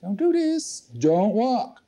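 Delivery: high-pitched urgent warning; warning inner voice. Acted. Inner voice warning a firewalker off the coals, urgent. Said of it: "a voice in there going".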